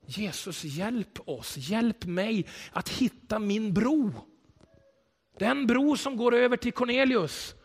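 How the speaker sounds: slow, earnest spoken prayer. Acted. Pastor praying aloud for the church, slow and earnest. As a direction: pray it slowly to Jesus.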